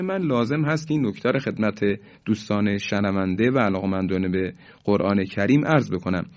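ordinary talk, nothing marked

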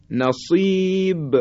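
"نصیب" high and drawn out, ب bounced at the end; qalqalah on ب. Shown as a demonstration. Teacher models the letter ba in "نصیب", bouncing qalqalah. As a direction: chant a long ī in "نصیب", then release the ب. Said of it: "حرف با".

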